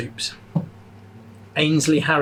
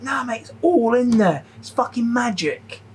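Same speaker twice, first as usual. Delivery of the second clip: higher, casual mock chef, slowing at the end; breezy chef; 9 semitones higher, slower than usual. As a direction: brush him off like the cocky chef. Chef dismissing his questions.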